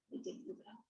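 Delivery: usual speaking voice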